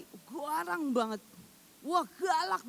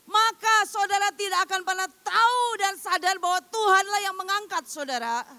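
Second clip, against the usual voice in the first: higher, louder pulpit exhortation; preacher pressing the church not to complain, fervent; fervent preaching; 8 semitones higher, 6 dB louder than usual. Acted. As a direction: drive the point home to the congregation. Preacher builds to full force.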